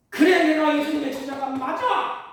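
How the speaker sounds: loud, proud declaration of faith; bold confession. Pretend. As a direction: proclaim it boldly as Peter should have. Imagined bold confession again.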